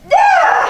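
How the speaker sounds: very high, loud frustrated yell; shouting in panic at the screen; anguished shout. Real, not acted. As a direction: scream out your frustration. Anguish over what to say.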